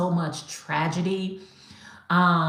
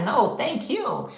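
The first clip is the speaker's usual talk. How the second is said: higher, slow and sincere; slow it down and mean it; earnest thanks; 7 semitones higher, slower than usual. Retold or imitated. Maker thanking the buyer back, slow and sincere. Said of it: "I'm like".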